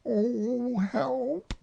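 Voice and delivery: high, drawn-out plea; stuck Pooh. A narrator's reading. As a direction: bear wailing from inside a jar. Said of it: "he said inside the jar".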